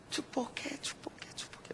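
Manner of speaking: very soft, fading echo; 메아리 imitation. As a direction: let the shout come back fainter and fainter. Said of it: "메아리가 이번에는 무슨 소리로". (아주 작게 사라지는 메아리)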